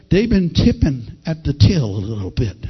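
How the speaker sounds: low, mildly mocking aside. Preacher acting out the mockers in the crowd, mildly mocking. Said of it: "Others mocking said".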